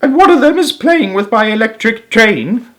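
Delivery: put-on high child's indignant cry; a little boy crying out in dismay; cried out. A narrator's reading. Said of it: "cried Philip".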